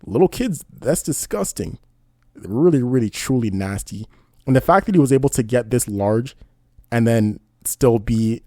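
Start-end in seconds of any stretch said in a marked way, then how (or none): 0.0-0.6 higher, sneering mock of a casual creep; mild mock of a casual creep. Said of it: "you're just drinking some tea and like". 0.7-4.1 drawn-out disgust. Real, not acted. Disgust after mock quote.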